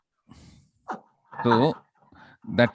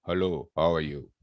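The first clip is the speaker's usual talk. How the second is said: lower, softer deep hello; baritone voice; 7 semitones lower, 3 dB quieter than usual. Shown as a demonstration. Teacher demoing a baritone voice to the class. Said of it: "What is baritone voice?".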